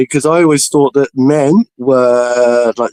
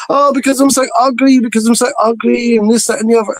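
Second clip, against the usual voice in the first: high, moaning imitation; self-pitying whine; 9 semitones higher than usual. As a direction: wail it like an insecure mate. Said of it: "He was going".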